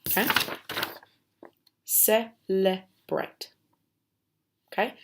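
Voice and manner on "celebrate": very slow model word. Shown as a demonstration. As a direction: Celebrate drawn out slowly. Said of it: "think about where the lines may be".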